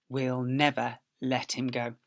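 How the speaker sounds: ordinary delivery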